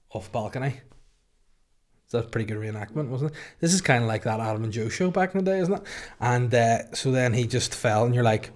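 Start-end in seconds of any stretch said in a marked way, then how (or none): none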